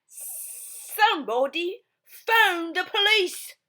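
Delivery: high, loud, slow dad voice; Mister Blair. Acted. Mister Blair calling for the police, high and loud. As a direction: boom it out slowly. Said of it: "said Mister Blair".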